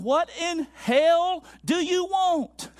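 retold preacher's thundering questions; imitating a fervent preacher. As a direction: preach it out as an impassioned challenge.

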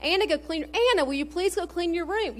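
mock-exasperated call to a child; exasperated mom. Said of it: "thinks sometimes I'm yelling".

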